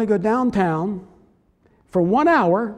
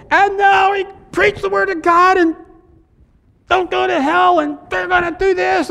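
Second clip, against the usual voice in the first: mock fiery preacher, much higher and louder; fire-and-brimstone evangelist; 12 semitones higher, 7 dB louder than usual. Preacher mocking typical evangelists, hammed-up fiery shouting. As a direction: ham up a hellfire revivalist thundering at the crowd. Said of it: "we think of people".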